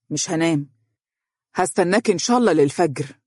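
usual speaking voice

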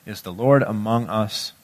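scripture reading. Acted. Preacher reads Exodus seventeen aloud.

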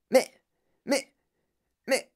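halting, stuck on one word; stammered cry. A narrator's reading. Madame Salt protests to Wonka about the drain, stammered cry. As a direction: stammer in mild alarm between pauses. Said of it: "cria madame Salt".

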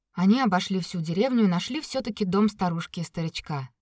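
ordinary delivery

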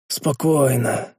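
slow, calming reassurance; soothing voice. A narrator's reading. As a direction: gently settle him down, slowly. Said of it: "увещевающим голосом проговорил я".

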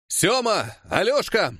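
high-pitched call. A narrator's reading. Worker calling to comrades in the crowd, shouting. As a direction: hail two comrades by name. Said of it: "крикнул я".

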